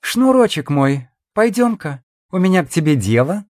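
high, flirty female voice; playful. A narrator's reading. Woman inviting her admirer aside on business, playful. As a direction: coax him along teasingly. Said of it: "игриво заговорила стерва".

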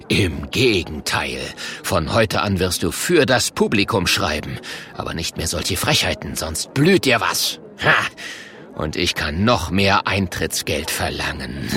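low, menacing captor's voice; greedy villain Murk, gloating. Acted. The Murk planning to profit from Oskar, low gloating villain. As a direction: lay down the rules, low and gloating.

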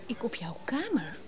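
hushed disbelieving whisper. Acted. Woman whispering denial to Freek about his room. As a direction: breathe the question, feigning innocence.

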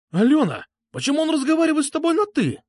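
very high, fast shrieked question; outraged squeal. A narrator's reading. Petrushka objects to the familiar address, outraged squeal. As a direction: squeal it in indignant disbelief. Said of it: "взвизгнул Петрушка".

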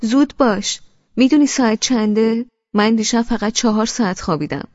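lively, joking. A narrator's reading. Husband hurrying his drowsy wife up, lively and joking. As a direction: chivvy playfully, full of energy. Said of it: "با صدایی سرحال و شوخ گفت".

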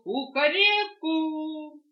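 long, high, loud crowing; rooster crow. Acted. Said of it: "захлопал крыльями и закричал".

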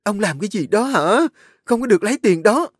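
high, loud, fast shouting wife; wife screaming at husband taking the money. Acted. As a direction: yell to stop him. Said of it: "bà hét lên".

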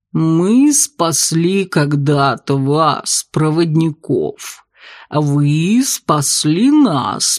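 slow and otherworldly, calmly; gurgling tentacle alien. Acted. Octopus bartender speaks.

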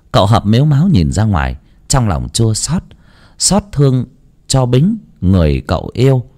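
plain reading voice

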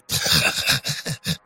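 breathy, high sinister snicker; evil laugh. Acted. The master gloating over the vanished man with a sinister snicker. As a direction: let a wicked laugh escape, under your breath.

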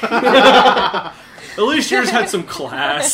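very slow, high, loud words through laughter; real laughter over the words; laughing. Real, not acted. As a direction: force it out while cracking up. Laughing at the joke.

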